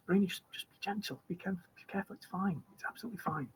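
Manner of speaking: soft, hushed motherly reassurance; soothing whisper. Acted. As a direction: whisper calm to a nervous child. Mum calms daughter near bird.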